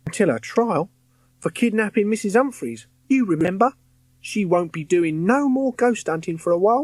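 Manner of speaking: put-on lively high Cockney boy's voice; chirpy London lad, cheeky; Cockney ghost boy. William, Cockney ghost, reassuring.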